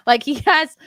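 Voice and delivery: ordinary talk, nothing marked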